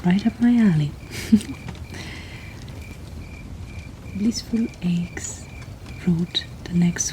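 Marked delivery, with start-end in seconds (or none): 1.0-4.1 small breathy laugh under the breath; soft chuckle. Real, not acted. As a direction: chuckle quietly to yourself. Amused after the chilling poem.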